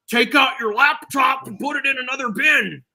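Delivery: high-pitched mimicked airport screener order; mock TSA agent. TSA agent ordering travelers at the checkpoint, high mock call.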